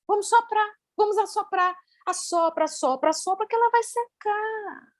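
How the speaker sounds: put-on high, soft sing-song voice for a small child; coaxing a little girl. Retelling how she calmed a crying girl, high and soft. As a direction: coax her gently to blow on the doll.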